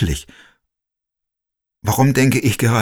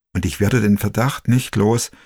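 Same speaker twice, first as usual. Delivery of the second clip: ordinary delivery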